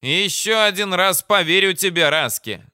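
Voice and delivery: high-voiced commander giving in; burly officer relenting; grudgingly deciding big man. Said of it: "наконец решился здоровяк".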